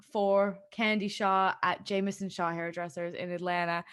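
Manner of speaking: ordinary talk, nothing marked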